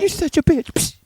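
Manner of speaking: very high mock squeal; mock insult. Friend retelling play wrestling, squealed mock insult. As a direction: pitch it way up, teasing. Playful name-calling in retold wrestling.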